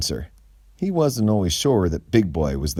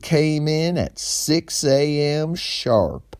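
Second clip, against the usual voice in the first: higher, slow nervous clerk; store manager voice; 8 semitones higher, 3 dB louder, slower than usual. Acted. Kenny describes his morning to Gray, higher and slow. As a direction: speak slowly like an awkward tall man. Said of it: "started Kenny".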